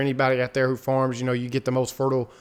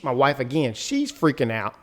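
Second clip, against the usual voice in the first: ordinary delivery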